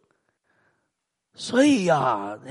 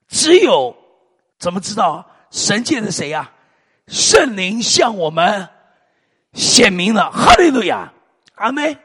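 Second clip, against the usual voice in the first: preaching climax; 3 semitones higher, 8 dB louder, slower than usual. Acted. Preaching climax, building loud.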